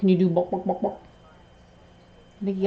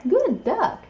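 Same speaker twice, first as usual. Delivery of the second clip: excited praise; 10 semitones higher, 4 dB louder than usual. Real, not acted. Praising parrot's quack.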